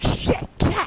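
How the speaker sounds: high shocked call of a name; alarmed cry. Retold or imitated. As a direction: cry out for a fallen ally. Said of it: "And you're like".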